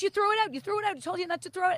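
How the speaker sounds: high, loud mock accusation; going crazy. Daughter accusing boyfriend of throwing it out, frantic. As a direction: blame him in a frantic pitch. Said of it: "she's going all crazy".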